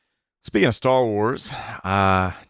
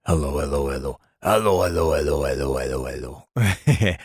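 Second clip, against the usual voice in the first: low, fading character voice; voice actor's character; 7 semitones lower than usual. Retold or imitated. Husband imitating a friend's podcast character, low and fading. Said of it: "he's got a character on there that comes on his podcast and goes".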